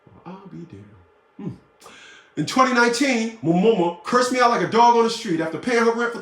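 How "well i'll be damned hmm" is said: much softer mutter under the breath; hushed dry mutter; muttered aside. Real, not acted. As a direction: say it almost to yourself.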